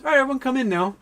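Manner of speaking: high, bright call-out; cheery mom. Retold or imitated. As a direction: sing it out sweetly. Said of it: "my mom says".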